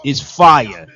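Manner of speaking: loud, fired-up radio host praise; hyped up. Real, not acted. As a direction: shout your excitement about the poem. Host hyped after a fiery poem.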